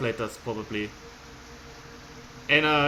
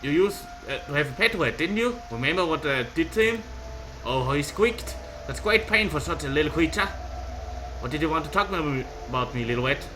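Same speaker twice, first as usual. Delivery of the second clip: menacing sister. Acted. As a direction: sneer "little rat" at her brother. Voices sister Jennifer.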